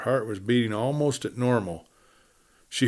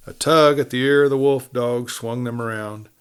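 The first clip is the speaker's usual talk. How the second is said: ordinary delivery, nothing marked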